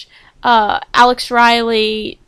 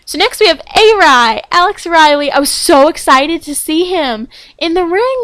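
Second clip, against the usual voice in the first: bursting with fan excitement, louder and higher; gushing excitement; 6 semitones higher, 6 dB louder than usual. Real, not acted. Fan gushing about Alex Riley's return, excited. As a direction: gush like a thrilled fan.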